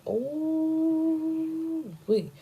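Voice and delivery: high, drawn-out scandalized ooh wee; shocked ooh-wee. Real, not acted. Vlogger reacting to the abortion reveal, scandalized ooh-wee. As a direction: stretch it out, gossip hitting hard.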